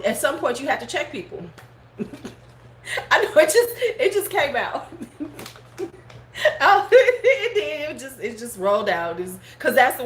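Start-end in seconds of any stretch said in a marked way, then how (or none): 2.0-7.9 laughing, higher and louder. Real, not acted. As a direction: giggle through it. Laughing at own outburst.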